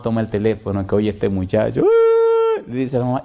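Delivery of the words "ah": high sobbing wail over the phone; a high, broken cry of grief; anguished wail. Retold or imitated. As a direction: let out a high crying wail. Son wailing on the phone.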